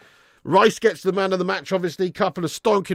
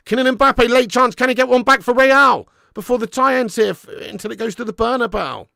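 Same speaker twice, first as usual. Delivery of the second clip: excited commentary; 7 semitones higher, 7 dB louder than usual. Real, not acted. Late chance, live commentary.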